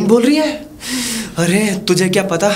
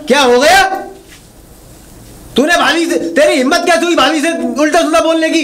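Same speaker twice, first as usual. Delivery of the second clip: angry confrontation; 5 semitones higher than usual. Acted. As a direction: tear into her. Husband confronting wife angrily.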